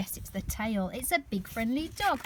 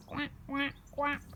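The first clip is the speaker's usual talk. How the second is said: higher, softer duck quack; playful quack; 4 semitones higher, 5 dB quieter than usual. Pretend. Showing the child the ducks, quacking.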